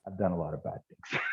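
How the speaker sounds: low, very quiet confession; hushed, low tough-guy reply. Retold or imitated. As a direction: imitate a tough guy admitting it under his voice. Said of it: "this tough guy goes".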